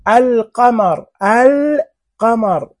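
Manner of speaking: "القمر" as al-qamar, with a long clear L; lam pronounced. Shown as a demonstration. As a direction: hold the L in "القمر", then a separate qamar. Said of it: "تنطق وتكتب". ("القمر" بإظهار اللام ومدّها)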